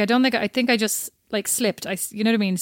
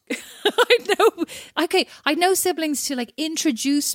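laughing; 7 semitones higher than usual. Real, not acted. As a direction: laugh through "I had no". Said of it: "laughing restart".

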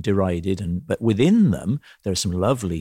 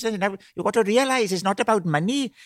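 much higher Welsh lilt, bargaining; Welsh piano teacher; 11 semitones higher than usual. Retold or imitated. Welsh teacher haggling with pupil over money, high Welsh voice. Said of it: "he goes".

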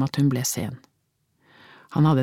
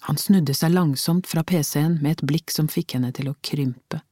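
plain reading voice

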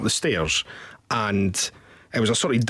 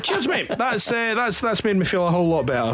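sarcastic thanks; 6 semitones higher than usual. Retold or imitated. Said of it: "And I was like".

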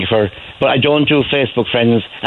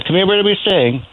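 higher, calling to her daughter; mother turning to daughter, higher; Cork woman to daughter; 8 semitones higher than usual. Retold or imitated. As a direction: ask the daughter, Cork mammy style. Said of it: "She said".

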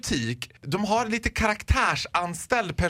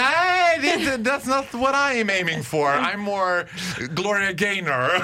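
mock protest; slower than usual. Customer turning down the shop assistant's style, mock protest. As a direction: protest in a raised, camp voice that settles.

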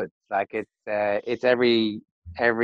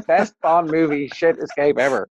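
ordinary talk, nothing marked

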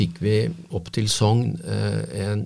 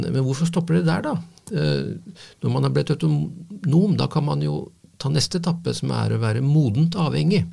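lightly imitated Indian accent, higher pitch; a hint of Indian English lilt, pitched up; 7 semitones higher than usual. Psychiatrist retelling an Indian colleague's question, light Indian accent. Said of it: "med sin indiske, engelske dialekt".

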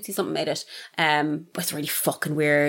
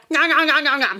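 high, loud mock chomping nom nom; gnawing noises; 8 semitones higher, 5 dB louder than usual. Co-host mimics gnawing on a leg, hammy chomping sounds. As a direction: chomp it like a cannibal.

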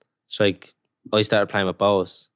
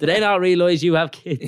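much higher mock wife, laughing through it; imitating the missus; 10 semitones higher, 3 dB louder than usual. Footballer mimics his missus complaining about the trip, high and laughing. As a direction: squeak it high as his annoyed partner.

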